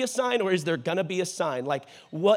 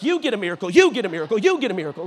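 loud mock giveaway announcer shout; Oprah; 6 dB louder, much faster than usual. Preacher playing host handing out miracles, mock hype. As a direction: punch each one out louder, crowing to the crowd. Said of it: "it's like Oprah up in here".

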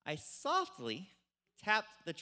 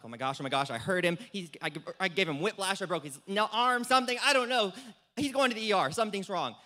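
retold panic; 3 semitones higher, 7 dB louder than usual. Driver panicking after hitting the truck, frantic and louder. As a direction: act out the rising panic. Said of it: "Now I'm freaking out even more".

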